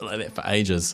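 usual speaking voice

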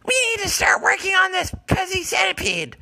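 very high, loud cat screech; high loud catlike meowing; shrieking cat voice. Acted. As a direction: yowl like a deranged cat doctor. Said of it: "why does your voice sound all fucked up".

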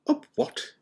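high-pitched startled question; baffled. A narrator's reading. McGonagall offered a lemon drop, baffled. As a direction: ask in sharp disbelief.

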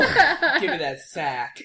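laughing, slow and drawn out. Real, not acted. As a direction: giggle through the words. Laughing at a gross joke.

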